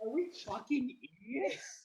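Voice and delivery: slow laughing, near tears. Real, not acted. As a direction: laugh through the words. Group cracks up at end.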